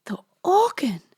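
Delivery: high-pitched astonished question; gasped surprise. A narrator's reading. Mistress taken aback by the visitor, high gasp. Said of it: "gasped Mistress Hurley".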